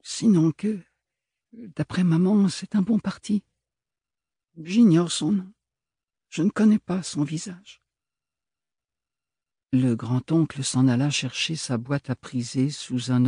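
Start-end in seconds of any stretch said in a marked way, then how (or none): 0.0-7.8 voix d'Ophélie plus haute, calme et réservée; jeune femme posée. A narrator's reading. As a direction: jeune femme qui explique sans s'émouvoir. Ophélie parle à son parrain. (Ophélie, légèrement plus haute, posée)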